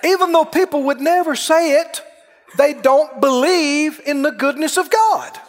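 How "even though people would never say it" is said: ordinary delivery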